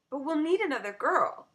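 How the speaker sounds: quick practical objection; eager objection. Acted. Girl objecting.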